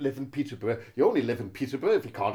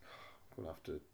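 mumbled apology; 8 semitones lower, 18 dB quieter, faster than usual. Real, not acted.

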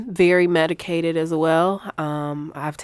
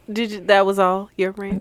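usual speaking voice

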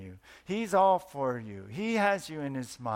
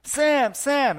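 shouting a name out the window at full volume; wife shouting the neighbour's name from the window; yelled out; 10 semitones higher, 10 dB louder, much slower than usual. Acted. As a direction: yell for the neighbour across the yard. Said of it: "and yelled out".

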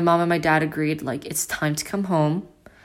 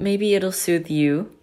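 soothing voice; 2 semitones higher, slower than usual. Shown as a demonstration. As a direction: lull the listener a little, slowly. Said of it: "have said that I have a very soothing voice".

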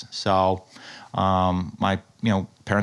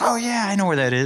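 knowing recognition; 11 semitones higher, faster than usual. Retold or imitated. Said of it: "or you're like".

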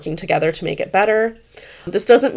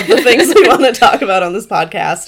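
laughing; 3 semitones higher, 9 dB louder than usual. Real, not acted. Laughing while describing their topic list.

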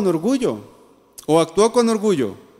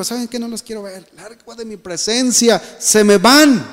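acted angry dismissal; 3 semitones higher than usual. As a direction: send them away with mock indignation. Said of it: "José no dijo".

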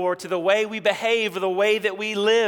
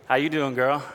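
put-on lower, cocky young boy voice; teen boy swagger; 6 semitones lower than usual. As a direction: play a middle school boy trying to be smooth. Middle school boys' walk voice.

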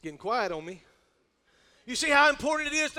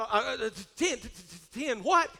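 stammering shock; slower than usual. Acted. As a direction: stutter in mock disbelief. Acts out shock at the payment.

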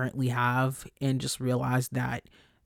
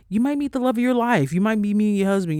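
higher and louder, gushing over meeting the love of your life; excited burst; 6 semitones higher, 5 dB louder, faster than usual. Real, not acted. Host tells listeners they may meet their love, excited. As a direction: lift right up with excitement at the thought of love.

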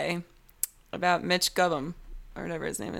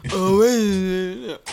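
ordinary delivery

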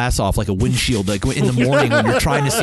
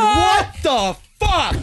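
outraged disbelief; 12 semitones higher, much slower than usual. Retold or imitated. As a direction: drag it out in shocked outrage. Fish dies after cleaning.

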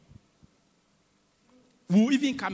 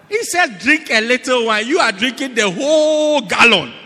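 loud, high-pitched preacher's rebuke; full-force preaching; 6 semitones higher, 10 dB louder than usual. Real, not acted. Preacher scolding drinkers over Timothy's little wine, full-force preaching. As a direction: belt it out to the congregation, drawing out the punchline.

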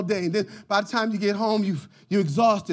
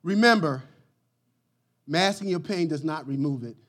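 usual speaking voice

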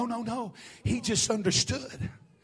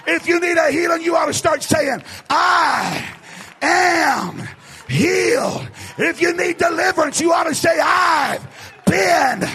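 higher, shouted revival preaching; full-force preaching; 7 semitones higher, 7 dB louder than usual. Real, not acted. Preacher urges the congregation to say it, shouting fervently. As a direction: drive the congregation to declare it, at a shout.

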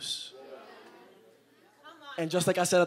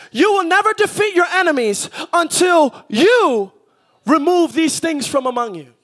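high, full-voice preacher's shout; shouted preaching; 13 semitones higher, 10 dB louder than usual. Acted. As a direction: drive it home to the whole church, shouting.

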